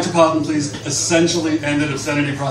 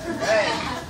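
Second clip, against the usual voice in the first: a bright high burst of laughter; real laugh; 8 semitones higher, 5 dB quieter than usual. Real, not acted. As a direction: laugh at the title you just read. Laughs at the chapter title.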